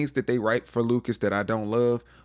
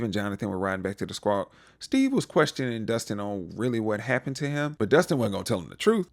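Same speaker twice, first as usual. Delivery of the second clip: usual speaking voice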